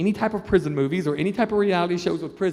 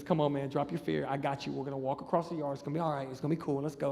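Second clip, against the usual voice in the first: lower, soft coaxing voice; acted hushed reassurance; convincing reassurance; 5 semitones lower, 10 dB quieter, faster than usual. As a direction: quietly talk a scared man into moving. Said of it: "he's like, convinces me".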